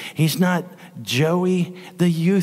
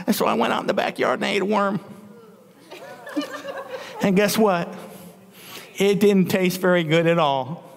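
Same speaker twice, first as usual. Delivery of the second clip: normal talking voice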